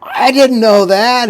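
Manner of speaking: high and slow, imitating a stunned teenager; stunned disbelief. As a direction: mimic the kid who can't believe it. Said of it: "couldn't believe it".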